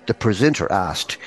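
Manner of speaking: ordinary delivery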